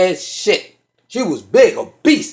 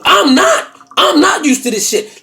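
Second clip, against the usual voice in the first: exasperated protest; 6 semitones higher than usual. Retold or imitated. Indignant retort to her reassurance.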